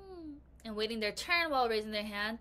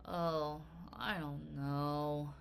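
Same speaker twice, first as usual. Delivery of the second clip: lower, slow lazy drawl; 7 semitones lower, 6 dB quieter, much slower than usual. Acted. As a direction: let it sag, slow and lazy. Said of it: "Mister Morris drawled".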